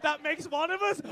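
loud heckling retort; shouted comeback. Real, not acted. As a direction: bark the comeback at the comedian.

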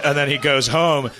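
normal talking voice

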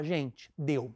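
lightly voiced, low and quiet; a low, soft word to the crowd; Adriano Imperador. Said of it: "o Adriano Imperador aparece e fala".

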